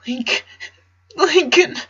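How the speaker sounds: put-on crying boy answering his father; sobbing. A narrator's reading. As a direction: choke out the name between sobs. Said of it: "between the sobs".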